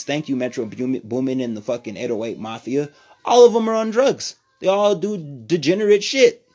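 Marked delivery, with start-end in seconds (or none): 3.2-6.4 loud rant. Real, not acted. Ranting about trap music.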